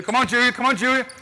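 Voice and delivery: high, loud come-on to a player; urging shout. Real, not acted. Coach urging a player mid-drill, high shout. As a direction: push her on with a yell.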